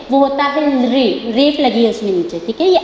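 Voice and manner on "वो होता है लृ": लृ said slowly and clearly, with the ri sound; careful pronunciation. Shown as a demonstration. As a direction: spell out लृ slowly and distinctly, like a teacher. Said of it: "pronunciation पर ध्यान दीजिए".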